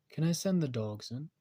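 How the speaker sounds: put-on Harley with a light British accent; a hint of a British accent as Harley. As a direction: ask sweetly in a British accent. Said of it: "Why did I give her a British accent?".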